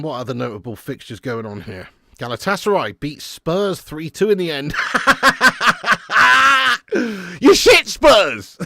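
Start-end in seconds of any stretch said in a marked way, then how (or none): 4.8-7.3 loud, high mocking cackle; gleeful laugh. Real, not acted. Fan cackling as Spurs lose, loud. 7.4-8.6 loud, slow, drawn-out taunt; gloating jeer. Real, not acted. Fan gloating at Spurs' defeat, loud slow jeer. Mocking rival team's defeat.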